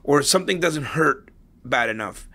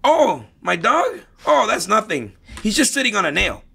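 folksy dog owner; 8 semitones higher than usual. Retold or imitated. Owner answers passerby in hound joke.

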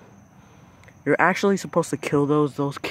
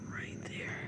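soft whispered repeat; hushed whisper pointing at the spider; 16 dB quieter than usual. Real, not acted. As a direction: whisper it like the bug could hear.

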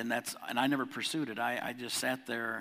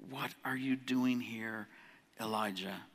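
slow, much softer, almost a hush; gentle whisper voice; 6 dB quieter, slower than usual. Acted. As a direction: let God's question drift in softly, slowly. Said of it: "Came a gentle whisper".

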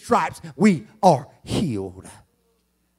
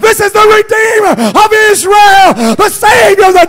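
much higher, much louder whoop, slow with a crying break on the Son of God; preaching at full force; 10 dB louder, slower than usual. Acted. Preacher whooping the centurion's words to the congregation, much higher and louder. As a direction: wail it out to the church.

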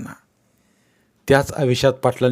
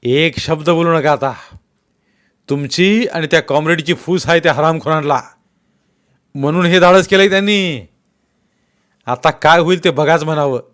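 loud, higher menacing warning; angry threat; 4 semitones higher, 6 dB louder than usual. Acted. Patil warning Bhagwanrao of consequences, angry threat. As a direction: lay the blame on him hard.

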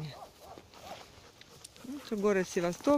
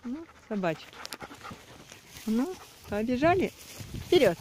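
urging dogs on; 5 semitones higher than usual. Real, not acted. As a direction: egg the dogs on.

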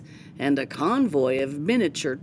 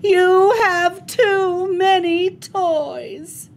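slow, loud, much higher mom voice; fed up, spell it out loud; exasperated yell; 12 semitones higher, 6 dB louder, much slower than usual. Acted. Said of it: "she yelled".

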